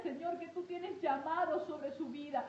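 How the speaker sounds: ordinary delivery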